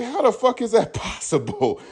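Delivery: high, slow disbelief with a laugh; can't believe it, laughing; incredulous. Retold or imitated. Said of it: "I'm like".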